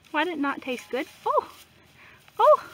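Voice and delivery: ordinary delivery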